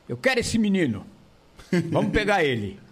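loud showman boss voice; Silvio Santos impression. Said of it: "que nem o Silvio falou".

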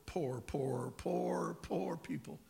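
"poor" repeated high and loud, each one stretched; emphatic repetition. Real, not acted. Preacher hammers repeated word for emphasis.

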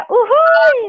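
high cheeky whoop; playful whoop. Real, not acted. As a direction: sing out a teasing cheer. Playful cheer.

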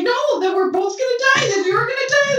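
very high-pitched frantic protest; hammed-up high squeal of alarm; mock panic. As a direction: imitate her panicking at the landmine. Retold landmine panic argument.

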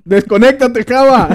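loud playful call across the table; teasing shout. Real, not acted. Friend calls out Java to stop zoning out, playful shout. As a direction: shout it out to tease him.

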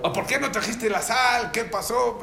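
high-pitched, indignant complaint; acted-out impatient demand; mock scolding. Husband complaining to his wife about missing salt, mock scolding.

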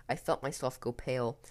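ordinary delivery, nothing marked

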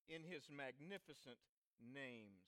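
usual speaking voice

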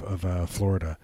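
ordinary talk

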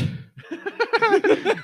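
squealing high laugh; helpless shrieking laughter; high-pitched laugh. Real, not acted. Friend about to pass out.

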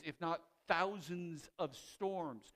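ordinary talk, nothing marked

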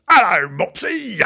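high, loud yelled hello; mock rough greeting. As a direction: bellow it as the ungentle version. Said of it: "Well, she's not gonna say".

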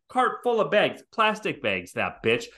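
ordinary delivery

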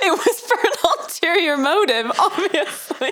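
high-pitched talk through laughter; laughing. Real, not acted. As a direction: barely get the joke out for laughing. Joking through her own laughter.

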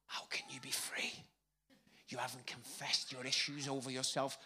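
whispered concern to the newcomer. Retold or imitated. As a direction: whisper it like a worried AA member. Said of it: "looked at me with a little bit of concern".

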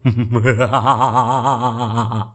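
low, drawn-out villain cackle; evil laugh. Acted. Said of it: "Kenapa gua ketawa jahat ya?".

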